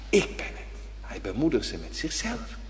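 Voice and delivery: soft, intimate hush; hushed tender. Acted. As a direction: drop to a gentle hush. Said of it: "hushed tender repetition".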